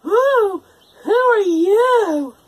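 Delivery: high, loud, very slow surprised voice; surprised girl. A narrator's reading. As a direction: gasp out the question. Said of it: "Rapunzel asked in surprise".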